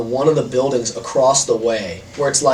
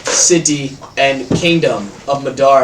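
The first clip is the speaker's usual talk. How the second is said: slow, raised orator's voice; grand drawn-out denunciation; booming public speech; 4 dB louder, slower than usual. Acted. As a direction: proclaim it slowly to the whole city. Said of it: "his voice is booming".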